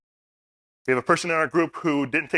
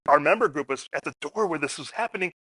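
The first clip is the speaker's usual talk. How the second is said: usual speaking voice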